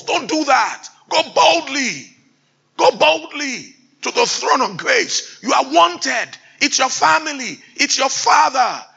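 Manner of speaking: high, loud fervent exhortation; full-force preaching cry; preaching shout. Real, not acted. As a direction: rouse them to come boldly.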